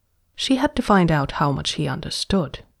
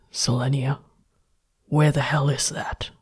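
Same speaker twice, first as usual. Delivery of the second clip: captive man. A narrator's reading. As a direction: bark out the questions, bewildered. Jax baffled.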